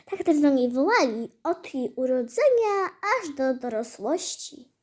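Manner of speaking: plain reading voice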